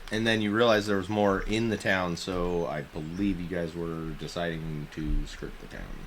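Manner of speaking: usual speaking voice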